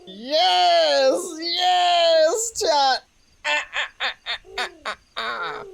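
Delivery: high, breathless giggling cheers; real giggly excitement; giddy laughter. Real, not acted. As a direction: laugh it out in pure glee. Celebrating the owl reveal.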